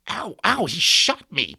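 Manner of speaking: high, loud cry of pain; pained scream. Acted. Shot Patrick cries out in pain, high scream. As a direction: yelp it like you've just been hit. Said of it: "Patrick screamed".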